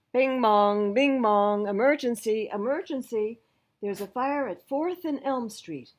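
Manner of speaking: loud chime call then urgent announcement; dispatcher alarm call. Acted. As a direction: announce the emergency over the speaker.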